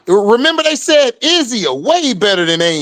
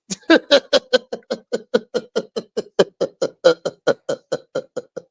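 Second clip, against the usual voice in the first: long laugh; 2 semitones lower, 4 dB quieter than usual. Real, not acted. Laughing at her own joke.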